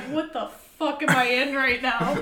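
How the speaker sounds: baffled in a class she can't follow; retold confusion.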